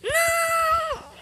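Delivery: high, drawn-out scream of no; anguished shout. Real, not acted. Gamer screaming as the attempt fails. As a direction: scream in dismay.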